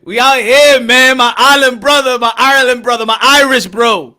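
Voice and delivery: big up him at full volume; hype shout-out. Real, not acted. Shout-out to the Irish anthem.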